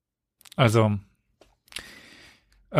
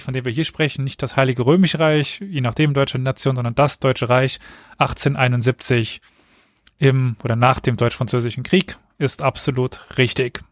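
ordinary talk, nothing marked

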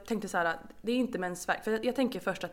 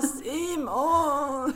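louder, higher pained call of the name; calling out again; 5 semitones higher, 6 dB louder than usual. Retold or imitated. Calling her husband again in pain, a louder pained call. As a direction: call out, hurting. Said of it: "så han bara".

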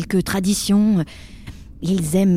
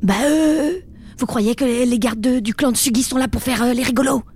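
mocking higher sarcastic jab; mock his hesitation with scorn; 5 semitones higher than usual. (ton sarcastique)

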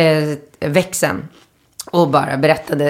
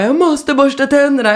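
higher-pitched imitation of a fretting child; lightly voiced little boy, pitched up; urgent child protest; 9 semitones higher than usual. Son to his hurried parent, urgent protest.

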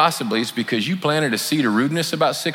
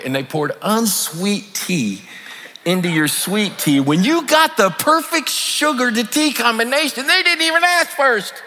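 rising outrage; 11 semitones higher than usual. Retold or imitated. Churchgoer griping about the waiter, rising into a squeaky mock outrage. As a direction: voice climbing higher and higher in mock indignation.

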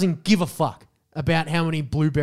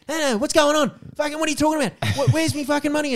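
put-on indignant old lady; 11 semitones higher, faster than usual. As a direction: squeal out her outrage like a confused old woman. Old lady voiced, confused protest.

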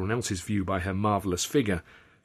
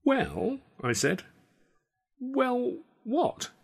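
very high prodding query; expectant; much slower than usual. A narrator's reading. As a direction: push him to finish. Pressing for an answer.

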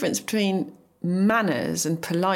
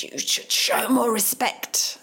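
slow, higher-pitched mock telling-off; mock indignant demand, slow and high; retold scolding; 6 semitones higher, slower than usual. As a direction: imitate an indignant friend, drawn out and raised in pitch, without shouting. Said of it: "was yelling up at me".

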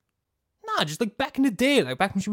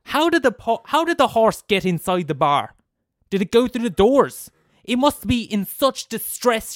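indignant rant; 7 semitones higher, 6 dB louder than usual. Acted. Michael indignant about the horse.

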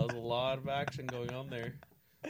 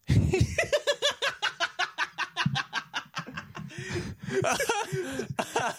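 loud laugh; 7 dB louder than usual. Real, not acted. Laughing at own slogan.

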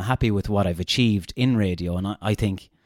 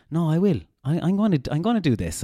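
higher, eager self-talk; determined inner voice; 7 semitones higher than usual. Retold or imitated. Radio host voicing the radio bug, determined and eager. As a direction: talk yourself into it, fired up. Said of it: "keeps going".

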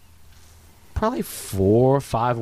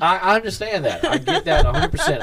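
laughing; 9 semitones higher than usual. Real, not acted. Laughing agreement.

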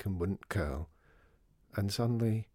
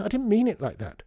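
put-on high, loud child's protest; upset protest; 12 semitones higher, 7 dB louder than usual. A narrator's reading. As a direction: object loudly, pitched up high. Child protests when accused.

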